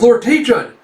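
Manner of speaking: high, loud cry to a lord; acted anxious shout; urgent call. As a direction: call out to him in alarm.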